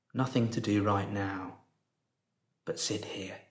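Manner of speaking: soft, unhurried meditation voice with long pauses; guided meditation. Real, not acted. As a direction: guide them gently into stillness, softly, leaving space after each phrase.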